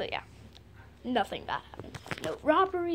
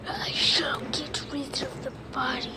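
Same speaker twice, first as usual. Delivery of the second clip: sinister whisper; 7 semitones lower, 4 dB quieter than usual. Acted. Villain whispers over music.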